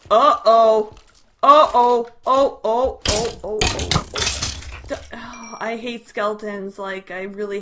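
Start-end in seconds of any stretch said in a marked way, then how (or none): none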